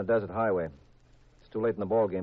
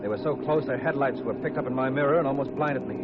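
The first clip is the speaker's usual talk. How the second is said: tense chase narration; 7 semitones higher than usual. A narrator's reading. Hard-boiled detective narrating the car chase, urgent. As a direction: drive the chase forward breathlessly.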